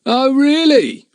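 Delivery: high, slow mocking "Oh, really?". A narrator's reading. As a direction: sneer at the boy's threat. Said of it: "said Jake in a mocking tone".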